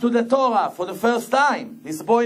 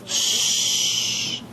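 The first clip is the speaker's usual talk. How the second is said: hushing shh; 4 dB quieter than usual. Acted. Quoting King David's hush.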